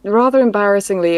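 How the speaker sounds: normal talking voice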